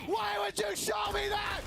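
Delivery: high, slow appalled yell; horrified shout. Real, not acted. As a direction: cry out in mock horror. Reacts to brutal replay.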